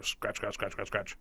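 hushed, repeated scratch noises; very soft onomatopoeic scratching; imitated scratching. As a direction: mimic something scratching softly in the dark. Imitates night-time scratching noise.

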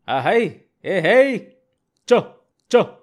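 loud, high whoops to the oxen; cattle-driving calls. A narrator's reading. Farmer urging his plough oxen onward, hollering. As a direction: drive the animals on with sharp cries.

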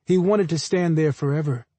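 ordinary delivery, nothing marked

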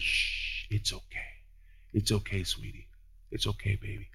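soft, hushed comforting whisper; calm a crying child; soothing hush. Pretend. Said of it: "the Holy Spirit says".

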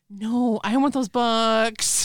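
put-on drawn-out, pleading kid whine; mock whining. Host plays the fan begging mom for books, mock whining. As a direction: drag it out like a begging child. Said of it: "Carrie is like".

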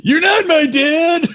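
high, drawn-out mock wail; hammed-up kid defying a stepdad; mock childish protest. Comic teasing the pitcher upset about his dad, mock childish wail.

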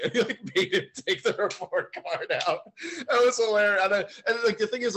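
high, loud laughter almost crying; hysterical laughing. Real, not acted. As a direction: lose it laughing.

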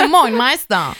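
repeating it through a laugh; amused echo of the greeting. Retold or imitated. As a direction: echo the line while laughing.

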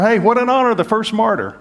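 high, bright mock consolation; hammed-up upbeat consoling; lightly mocking cheerful platitude; mock cheery consolation.

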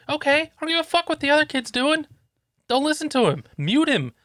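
very high-pitched exasperation; hammed-up dad at the end of his patience; retold exasperated rebuke. Dad dismissing his kid's excuses, exasperated. Dad's third-time rebuke re-enacted.

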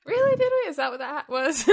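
high, loud mock disbelief; mock surprise. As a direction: act shocked and offended. El mock-shocked at teasing.

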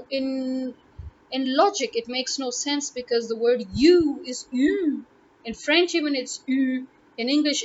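usual speaking voice

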